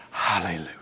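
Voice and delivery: low breathy hushed hallelujah; whispered hallelujah. Acted. Preacher voicing the redeemed multitude, whispered in awe.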